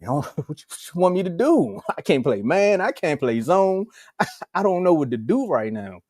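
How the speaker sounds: high, pleading, laughing coach; flustered coach impression. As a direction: play the helpless coach, laughing. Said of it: "He was up there like this".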